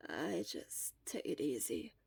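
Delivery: barely muster the words; tired. Acted. Shura worn out from coughing, tired and soft. Exhausted boy answers.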